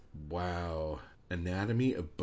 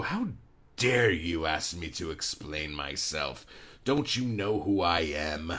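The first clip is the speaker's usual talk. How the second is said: a lightly put-on pompous voice, huffy and offended; indignant and precious; 3 semitones lower than usual. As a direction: ham up a pompous author taking offence. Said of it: "be all fucking indignant and precious and go".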